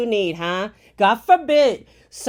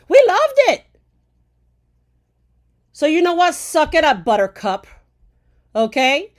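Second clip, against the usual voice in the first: loud, higher angry yelling; real furious shouting; furious rant; 5 semitones higher, 4 dB louder than usual. Real, not acted. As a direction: let the fury out at the critics. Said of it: "I'm fucking furious".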